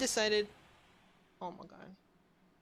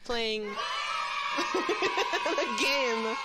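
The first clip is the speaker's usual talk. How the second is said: laughing shout; 2 semitones lower, much slower than usual. Real, not acted. Finally finishes the sentence, laughing.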